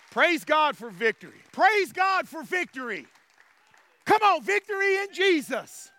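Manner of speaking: building praise shout, climbing in pitch; exultant preaching. Real, not acted. Preacher leading praise for victory, exultant preaching.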